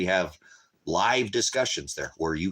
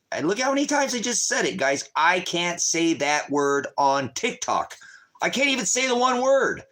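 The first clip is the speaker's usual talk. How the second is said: exasperated outburst; 8 semitones higher than usual. Real, not acted. Exasperated about banned word.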